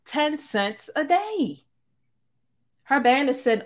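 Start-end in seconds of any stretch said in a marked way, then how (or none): 0.0-1.6 mocking drawn out. Mocking her low price.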